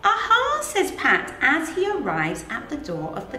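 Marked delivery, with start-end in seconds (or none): none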